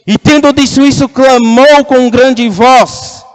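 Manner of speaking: slow, loud scripture proclamation; proclamação forte. A narrator's reading. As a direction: ring it out with a big voice. Proclama o clamor de Jesus. (clamando alto e pausado)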